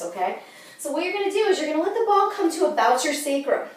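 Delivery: ordinary talk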